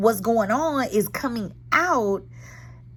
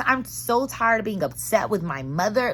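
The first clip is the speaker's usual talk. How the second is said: normal talking voice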